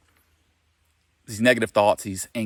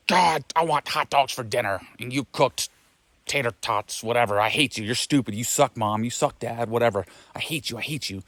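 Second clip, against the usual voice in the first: put-on angry kid; 3 semitones higher than usual. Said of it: "if an angry kid's like".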